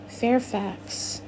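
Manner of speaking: ordinary delivery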